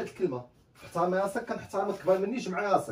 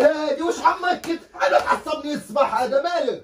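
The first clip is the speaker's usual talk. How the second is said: angry; 6 semitones higher, 6 dB louder than usual. Acted. Brother angry outburst.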